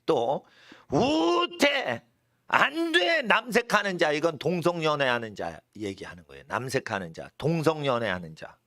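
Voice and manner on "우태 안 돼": high, drawn-out proclamation; emphatic prohibition. Acted. Preacher acting out the original text's list of bans, emphatic prohibition. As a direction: intone each ban high and forcefully.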